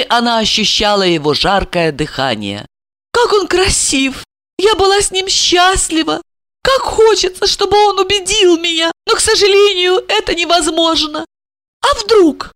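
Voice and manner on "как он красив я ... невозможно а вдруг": high-pitched, wistful inner voice; bitter longing. Acted. Wife longs to believe her husband, bitterly. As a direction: think it aloud with painful yearning. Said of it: "с горечью подумала она".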